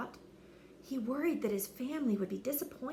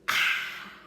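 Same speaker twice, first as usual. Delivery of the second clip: gasp; 4 dB louder than usual. Acted. Dragon tries to breathe.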